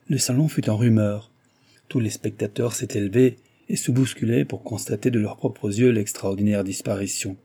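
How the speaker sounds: ordinary delivery, nothing marked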